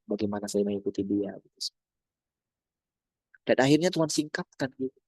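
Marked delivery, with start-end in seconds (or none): none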